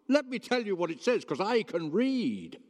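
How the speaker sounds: high, boastful mock voice with a laugh; mocking smug show-off. To the crowd, showing off he can read, high smug mock voice. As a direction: lord it over them like a know-it-all. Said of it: "Bit like Michael McIntyre".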